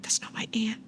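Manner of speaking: hushed whisper to herself; whispered aside. Retold or imitated. Realizing the caller on the phone is not her aunt, whispered. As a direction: breathe it out under your breath. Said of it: "And I'm like".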